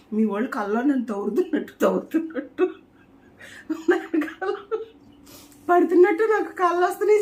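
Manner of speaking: laughing through the words, pitch climbing high and loud; giggling as the story gets absurd. Real, not acted. Laughing while retelling a nightmare. (నవ్వుతూ, గొంతు పైకి ఎక్కుతూ)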